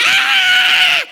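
shrieking Ah, very high and loud; piercing scream. Acted. The boy screaming as the spirit leaves. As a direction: let out a wild scream.

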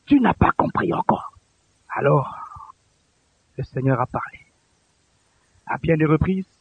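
low, soft, with long pauses; hushed solemn preaching. Real, not acted. Preacher telling how the Lord spoke, hushed and solemn. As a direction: let each phrase settle quietly.

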